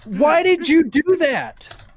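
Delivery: high, loud, slow outcry; shocked shout. Real, not acted. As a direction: yell it in disbelief. Player reacts to amputation.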